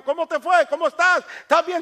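high, loud string of questions; anxious mother. Acted. Mother checking on her freed son, loud and anxious.

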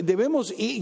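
normal talking voice

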